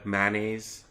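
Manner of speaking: ordinary talk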